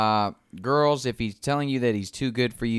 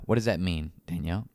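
ordinary delivery

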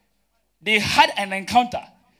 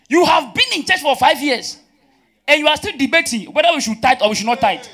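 raised, high preacher's reproach; exasperated scolding; 6 semitones higher, 6 dB louder than usual. Real, not acted. Preacher scolds members still debating tithing, exasperated. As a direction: take the congregation to task loudly.

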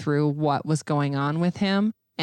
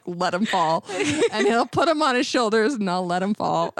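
words breaking up with laughter, pitched higher; laughing; 4 semitones higher than usual. Real, not acted. Laughing to the host about dropping husband's arms, giggling. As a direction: giggle through it at your own pettiness.

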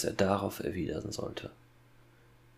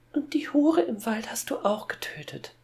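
very high put-on girl's voice; Gretel voice. A narrator's reading. Boy accusing father in his sister's voice, high girl's voice. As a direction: pitch it right up as a girl. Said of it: "fügte Hänsel mit der Gretelstimme hinzu".

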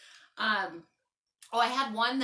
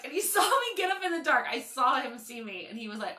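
ordinary talk

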